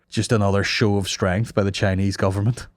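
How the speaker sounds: usual speaking voice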